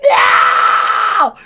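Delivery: high, drawn-out mock shriek; hammed-up screaming protest; acted tantrum. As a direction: yell it like someone throwing a whole fit. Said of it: "a whole fit".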